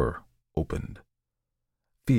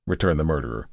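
plain reading voice

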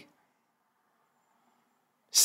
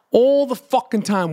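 normal talking voice

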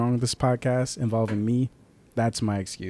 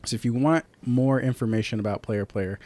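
normal talking voice

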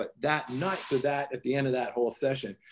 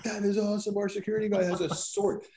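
a much higher, delighted gush; gleeful awe; 8 semitones higher, faster than usual. Retold or imitated. Telling the tribesmen, delighted their guard has a sword. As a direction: gush like a kid who just heard great news. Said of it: "We were like".

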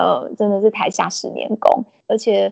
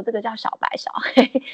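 whisper. Retold or imitated. Mother-in-law selling peanuts, whispering the names. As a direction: breathe the names out like a secret.

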